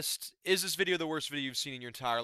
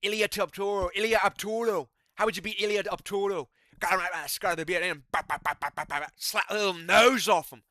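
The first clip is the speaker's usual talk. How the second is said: brash mock Irish accent, higher and louder; McGregor impression; 3 semitones higher, 5 dB louder than usual. The fighter boasting how he'd win, mock Irish accent. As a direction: swagger it in an Irish brogue. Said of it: "I can't really do a McGregor anymore".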